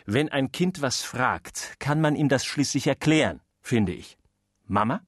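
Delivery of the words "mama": ordinary talk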